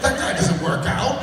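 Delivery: teasing through laughter; laughing. Real, not acted. Host teasing his cohost, laughing. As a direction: laugh while you say it.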